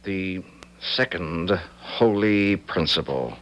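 slow, dutiful repetition; slow echo. Acted. Son dutifully echoing his father, slow. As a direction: repeat it word by word.

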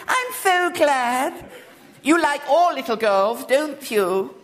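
put-on high, slow childish lisp; lisping little girl. A narrator's reading. Little girl asks William's name, lisping high and slow. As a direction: lisp it like a spoilt small girl.

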